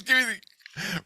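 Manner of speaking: high, drawn-out demand; strained high order; mock angry demand. Housemate demands the gun, acted.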